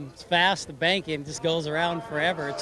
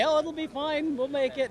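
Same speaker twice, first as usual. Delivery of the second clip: higher-pitched imitation of a reassuring crew; lightly voiced crew reassurance; 9 semitones higher than usual. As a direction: lightly mimic them brushing off the worry, pitched up. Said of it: "the crew kept telling me".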